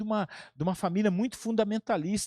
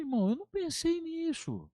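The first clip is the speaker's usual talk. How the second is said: high, softer baffled protest; perplexo; 9 semitones higher, 5 dB quieter than usual. Retold or imitated. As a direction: pitch it right up in mock helplessness. Resposta perplexa encenada. (protesto agudo e perplexo, encenado)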